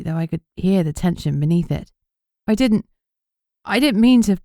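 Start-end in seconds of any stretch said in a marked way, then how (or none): 2.5-4.4 higher, louder, pleading repeat; distraught protest. A narrator's reading. Beatrix protesting to Fraser she didn't mean it, distraught and higher. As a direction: plead that it was an accident.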